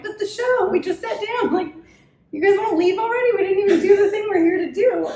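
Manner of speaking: high mock protest. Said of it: "I'm like".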